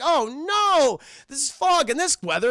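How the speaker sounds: high, loud mock despair; theatrical retold dismay; dismayed Jack. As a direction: wail it like a ruined showman. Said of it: "Jack is just like".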